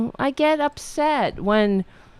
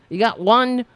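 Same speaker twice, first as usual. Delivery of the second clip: ordinary talk, nothing marked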